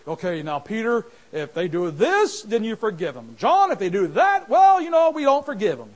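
loud, mocking rule-giver; mock pick-and-choose. Preacher mocking a made-up Jesus in a loud voice. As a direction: ham up the false teaching.

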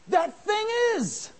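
high, loud, drawn-out shout; preaching shout. Acted. Preacher shouting the point home to the church. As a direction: cry it out to the rafters.

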